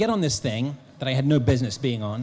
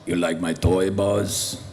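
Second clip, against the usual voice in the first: lower, teasing giant's voice; teasing Andre voice; 4 semitones lower, slower than usual. Retold or imitated. Andre the Giant taunting the actor from his ATV, low and teasing. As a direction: taunt him like a playful giant. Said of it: "he would taunt me".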